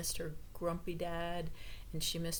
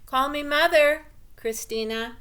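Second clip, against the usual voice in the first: higher and loud, easing on the name; shouted back; 8 semitones higher, 11 dB louder than usual. A narrator's reading. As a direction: shout back firmly to the daughter. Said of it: "Mildred shouted back".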